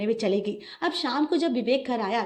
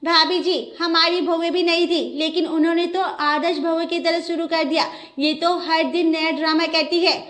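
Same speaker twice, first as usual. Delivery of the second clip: higher and louder indignant neighbour; 6 semitones higher, 5 dB louder than usual. Acted. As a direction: push back hard, scandalised. Neighbour insists, sharper.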